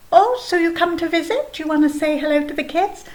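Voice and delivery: ordinary delivery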